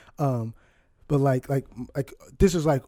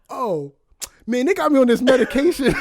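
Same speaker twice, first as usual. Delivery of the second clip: sheepish laughing; 7 semitones higher, 4 dB louder than usual. Retold or imitated. Dad realizes mistake.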